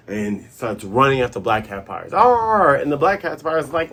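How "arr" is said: loud, high "arr"; charging roar. Retold or imitated. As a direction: imitate a battle cry on the attack. Imitating Luffy charging.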